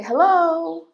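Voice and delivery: high, loud call of hello into the office. Retold or imitated. Calling to staff hidden in the office, loud hello. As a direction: call out to get noticed. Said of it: "So I say".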